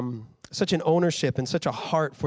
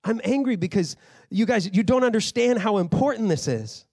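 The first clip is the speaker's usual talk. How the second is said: raised, heated paraphrase of an angry apostle; fired-up Paul; 7 semitones higher than usual. Retold or imitated. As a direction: scold them like a hurt, angry father. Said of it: "that's kind of what Paul's saying".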